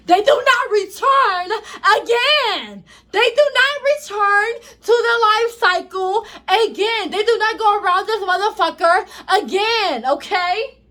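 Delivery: loud, high, worked-up preaching; hyped. Real, not acted. Ranting at viewers about the life cycle, hyped and loud. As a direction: hammer the point home, fired up. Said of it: "I'm getting so hyped".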